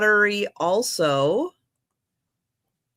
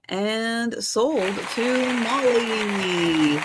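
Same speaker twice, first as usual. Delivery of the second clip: auctioneer call; much slower than usual. Acted. Mock auction countdown.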